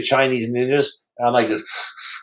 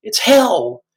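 high shouted defiance; defiant yell; 9 semitones higher than usual. Retold or imitated. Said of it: "I'm like this".

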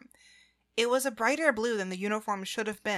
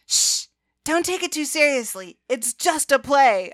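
high, loud teasing reproof; mocking hush; 5 semitones higher, 9 dB louder than usual. A narrator's reading. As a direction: throw the scolding back gleefully. Teasing back with his own words.

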